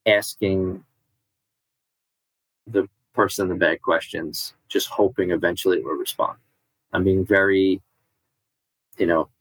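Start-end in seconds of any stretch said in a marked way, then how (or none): none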